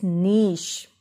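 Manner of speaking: "niche" as NEESH; correct SH ending. Shown as a demonstration. Teacher urging careful listening, niche as NEESH. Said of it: "listen to me very carefully".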